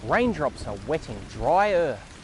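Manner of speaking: put-on slow, sing-song reading for small children; picture book reading. Reading Thank You Rain aloud to young viewers, gentle storytelling. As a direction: read it out like story time, savouring each word.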